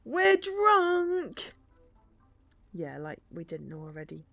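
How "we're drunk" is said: put-on screaming drunk. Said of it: "starts screaming in the taxi, a deep male voice with a southern accent".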